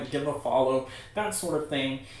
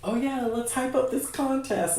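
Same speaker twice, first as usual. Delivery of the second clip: high, mock-excited hype; 10 semitones higher than usual. Mocking her own last-minute contest hype, high mock excitement. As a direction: chirp it like an eager last-minute promoter. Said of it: "to kind of be like".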